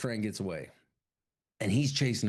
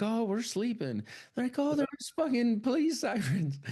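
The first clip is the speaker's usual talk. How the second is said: much higher, fake innocent voice; mock innocent; 12 semitones higher than usual. Farmers in robes faking sleep, high mock innocent. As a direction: play dumb in a high voice. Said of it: "And they're like".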